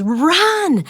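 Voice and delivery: sudden high urgent shout of run; shouted command. Retold or imitated. Guide yelling at the speaker to flee gunfire, urgent shout. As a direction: yell it like bullets are flying.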